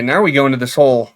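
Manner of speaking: normal talking voice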